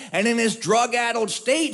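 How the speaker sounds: usual speaking voice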